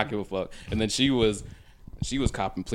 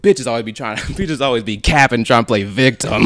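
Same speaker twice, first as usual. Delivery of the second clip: loud dismissive voice; 3 semitones higher, 12 dB louder than usual. Retold or imitated. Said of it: "He said".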